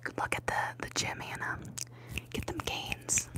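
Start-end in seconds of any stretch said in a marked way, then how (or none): none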